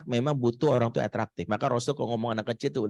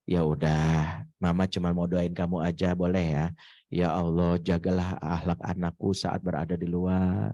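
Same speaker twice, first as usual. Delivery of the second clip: gentle mother's prayer; 7 semitones lower than usual. Retold or imitated. Mother praying for teen.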